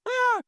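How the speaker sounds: a sudden high yelp of mock dismay; high shocked yelp. Real, not acted. Reacting to Pepsi losing millions, a high startled yelp.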